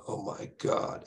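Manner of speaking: breathy hushed gasp of dread; whispered panic. Retold or imitated. Driver spots police lights, hushed whisper. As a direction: whisper it as the cop lights appear.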